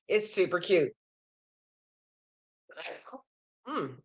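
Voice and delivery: strained, fading voice; losing voice. Real, not acted. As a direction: voice giving out. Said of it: "I think I'm losing my voice".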